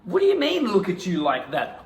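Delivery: high, loud offended squeal; outraged disbelief. Pretend. Actor in improv, outraged at the accusation. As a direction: throw her words back in disbelief.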